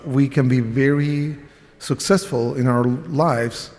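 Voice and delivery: ordinary delivery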